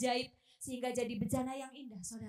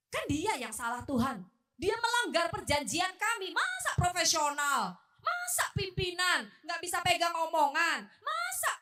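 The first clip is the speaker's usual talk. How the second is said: louder, higher protesting voice; indignant self-defence; 7 semitones higher, 7 dB louder than usual. Acted. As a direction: protest loudly in your own defence. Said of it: "kalau saya mau bela diri". (membela diri dengan kesal)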